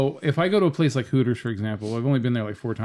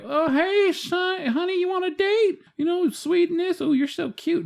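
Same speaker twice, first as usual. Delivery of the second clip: mock a sugary come-on, pitched way up; flirty waitress. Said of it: "they don't talk to you like".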